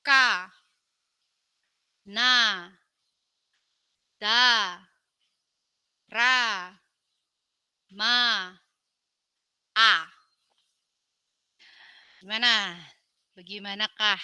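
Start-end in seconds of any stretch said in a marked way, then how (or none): 0.0-9.9 syllables called out louder, pausing after each; syllable drill. Shown as a demonstration. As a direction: lead a repeat-after-me drill. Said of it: "Kita lihat lagi, ulang".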